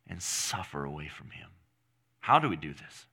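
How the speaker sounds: ordinary delivery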